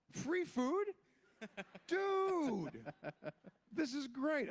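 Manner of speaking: high, thrilled burst about free food; excited. Retold or imitated. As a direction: squeal it like a broke actor hearing free food. Retells getting offered free lunch.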